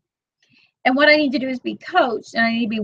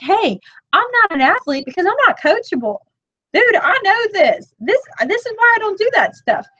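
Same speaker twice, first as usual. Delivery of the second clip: exasperated retort; 9 semitones higher than usual. Retold or imitated. Telling viewers how she answered her husband's coaching jab, exasperated. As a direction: toss his words back at him, half-laughing.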